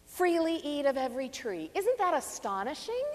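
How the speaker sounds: ordinary talk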